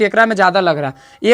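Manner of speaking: ordinary delivery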